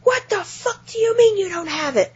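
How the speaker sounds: high-pitched outraged rant; irate customer. Retold or imitated. Caller learns the magazine is gone, high mock outrage. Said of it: "And he flipped his shit".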